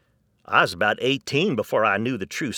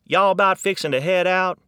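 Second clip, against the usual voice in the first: Texas drawl, bright and loud; Texan accent, lively; 8 semitones higher, 5 dB louder than usual. Retold or imitated. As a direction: toss it off like a Texan on the porch. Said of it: "they are from Texas".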